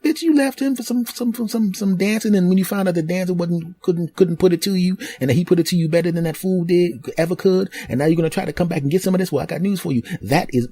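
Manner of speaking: high put-on woman's voice, ranting; sassy rant. New girlfriend ranting at the ex, sassy. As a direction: lay into her with attitude.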